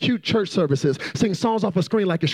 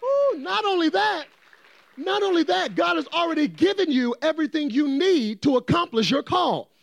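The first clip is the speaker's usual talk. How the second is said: full-force preaching; 6 semitones higher than usual. Real, not acted. Preacher to congregation, whooping into full-force preaching. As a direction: preach it like the spirit just hit. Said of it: "I feel like preaching now".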